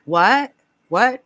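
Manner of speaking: imitated loud, rising "what?"; baffled sister. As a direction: retell a puzzled, insistent double question. Voices sister baffled by mishearing.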